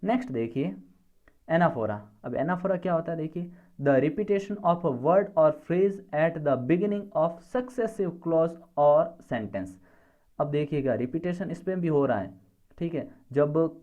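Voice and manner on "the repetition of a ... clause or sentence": very slow, word by word; spaced-out dictation read. Shown as a demonstration. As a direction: dictate it so students can write. Teacher dictating a definition.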